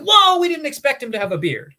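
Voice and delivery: high, loud, quick comic surprise; mock astonishment. Teacher voicing guards meeting Jesus, mock astonished. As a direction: blurt it like a startled guard, hammed-up.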